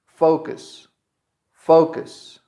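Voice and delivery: "Focus" stressed on fo, short cus; stress on first syllable. Shown as a demonstration. English teacher models a verb, stress on first syllable. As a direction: say "Focus" with a strong FO and weak cus. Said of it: "The stress is in fo".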